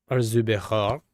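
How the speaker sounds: put-on German word with a French-sounding accent; botched German accent. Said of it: "more of a French accent than a German one".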